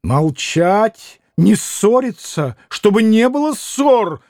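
commanding, climbing in pitch to the end; peremptory scolding; imperious cry. A narrator's reading. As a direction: order them to stop, rising to a high cry. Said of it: "крикнула повелительно Грушенька".

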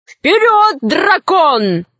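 high cheering yell; shouting. A narrator's reading. Robbers cheer on the dragon, high shout. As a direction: urge the fighter on at high pitch. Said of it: "Разбойники закричали".

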